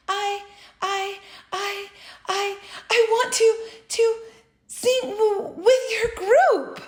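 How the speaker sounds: high nervous stuttering, half laughing; shy stammer. A narrator's reading. Frog stammering his wish to the head bird, nervous. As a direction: stumble over every I.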